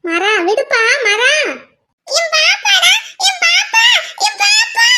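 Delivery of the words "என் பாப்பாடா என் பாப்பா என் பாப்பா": high, insistent squabbling cry; staking a claim in a children's fight; possessive child's yell. Acted. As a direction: shout it higher with each claim. Children squabbling over the baby.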